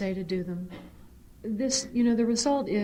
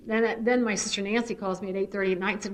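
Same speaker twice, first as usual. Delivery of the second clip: ordinary talk, nothing marked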